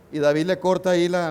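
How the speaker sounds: normal talking voice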